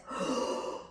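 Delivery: sharp breathy gasp up into the chest; shallow chest gasp. Shown as a demonstration. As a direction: show the wrong breath. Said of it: "It wasn't this".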